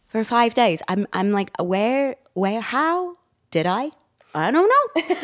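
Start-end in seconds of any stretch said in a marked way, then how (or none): 1.6-5.2 halting questions, jumping up high at the end; puzzled, drawn-out mock bewilderment; baffled self-questioning. Guest acting out her lost memory of sleep, baffled.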